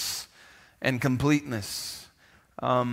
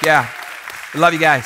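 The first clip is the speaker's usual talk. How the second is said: excited shout-out; 2 semitones higher, 6 dB louder than usual. Real, not acted. Cheering online church family.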